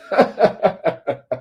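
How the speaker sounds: quick staccato chuckle; mocking laugh. Real, not acted. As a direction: laugh at their ignorance.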